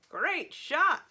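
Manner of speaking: high, loud game announcer voice; automated voice. A narrator's reading. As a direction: call it out like a video game. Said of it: "said the automated voice".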